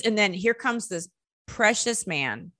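usual speaking voice

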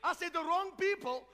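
high, loud preacher's declaration; full-force testimony preaching. Real, not acted. Pastor testifies God changed his life, full-force preaching.

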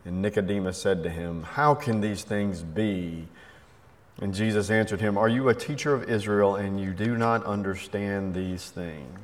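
solemn scripture reading. Acted. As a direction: read the gospel aloud with reverence. Reads the gospel passage aloud.

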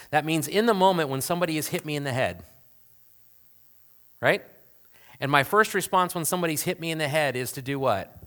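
ordinary delivery

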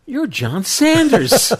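high, slow amazed recognition; surprised. Retold or imitated. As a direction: gasp it out like meeting a star. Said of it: "it's like".

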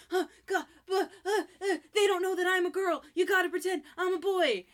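high, loud panicked wail; panicking girl begging him to keep secret; frantic. Retold or imitated. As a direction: blurt it in a crying panic. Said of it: "She's frantic".

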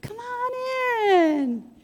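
high, slow, sugary put-on welcome after a shouting match; fake sweet greeting. Caught mid-fight, greeting the pastor at the door with fake sweetness. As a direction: sing out a falsely cheerful hello. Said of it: "you'd be like".

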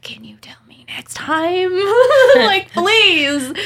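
high, mock-desperate plea breaking into laughter; exasperated plea. Groomer begs receptionist to tell her next time, exasperated. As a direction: plead it high, ending loud and laughing.

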